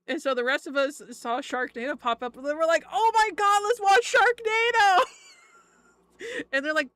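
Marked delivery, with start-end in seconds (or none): 2.9-5.0 high, loud thrilled group cheer; excited shout. Retold or imitated. Streamer voices stoned friends spotting Sharknado, excited shout.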